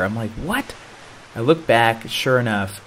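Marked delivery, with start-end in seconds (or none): 0.3-0.7 very high, soft rising yelp; startled question. Retold or imitated. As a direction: pitch it right up, bewildered. Startled at nearing the pier.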